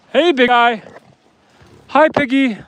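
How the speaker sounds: high, loud greeting to an animal; pet talk. Real, not acted. Farmer greeting pigs headed for the trailer, pet talk.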